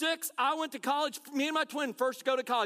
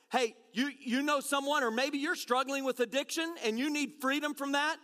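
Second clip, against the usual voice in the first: ordinary talk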